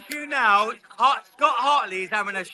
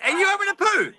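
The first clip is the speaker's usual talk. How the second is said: teasing; 10 semitones higher than usual. Real, not acted. Teasing call to friend.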